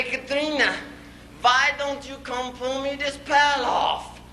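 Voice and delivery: high comic complaint, not yet yelling; exasperated demand. Acted. Husband asking laughing wife for help, exasperated. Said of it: "Then finally I get so mad".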